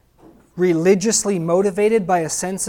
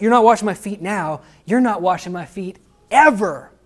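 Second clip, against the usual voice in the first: starts louder and climbs to a high, loud cry; building indignant protest; 4 semitones higher, 6 dB louder than usual. Acted. Peter refusing Jesus washing his feet, building to a high loud cry. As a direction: let Peter's refusal swell until it bursts out.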